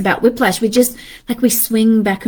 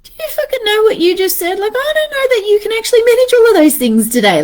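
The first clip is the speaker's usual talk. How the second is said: high, fast disbelieving husband; husband's mock disbelief; incredulous husband; 10 semitones higher, faster than usual. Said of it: "his face looking at me like".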